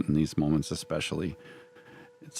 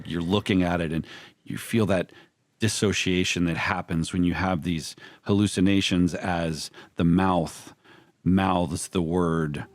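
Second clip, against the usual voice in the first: ordinary talk, nothing marked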